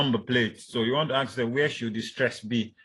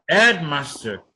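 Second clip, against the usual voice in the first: headmaster with HEAD high and loud; stress on first word; 4 semitones higher, 8 dB louder, slower than usual. Shown as a demonstration. Teacher showing compound stress, headmaster stressed loudly on HEAD. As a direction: punch HEAD, let master fall. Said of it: "the head takes the stress".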